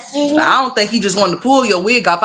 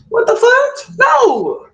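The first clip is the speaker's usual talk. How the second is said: much higher, shocked squeal; mock outrage; much slower than usual. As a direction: squeal in fake horror. Outraged at man's bigger backside.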